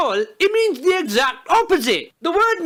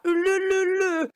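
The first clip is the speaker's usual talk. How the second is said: put-on higher, cooing baby talk; 5 semitones higher than usual. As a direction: coo at the baby, mocking the women. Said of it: "they're so natural with that baby talk".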